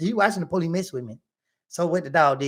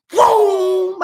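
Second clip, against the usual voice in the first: a high, loud "Boom!" for the car taking off; imitated engine boom; 14 semitones higher, 9 dB louder than usual. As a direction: burst out with the engine's roar. Peeling off with red key.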